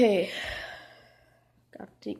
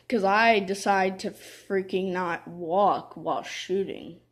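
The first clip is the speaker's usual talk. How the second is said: normal talking voice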